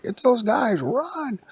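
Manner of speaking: high, loud panicked yelp; frightened robots shrieking a warning; panicked cry. Acted. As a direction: squeal it out in alarm. Animatronics panic at intruders.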